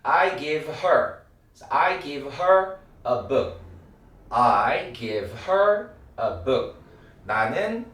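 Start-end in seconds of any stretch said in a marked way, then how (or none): 0.0-6.8 very slow, leaning on her; slow drill. Shown as a demonstration. Teacher modeling her for students. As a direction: draw out each word, land on her. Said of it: "her라는 발음이 돼요".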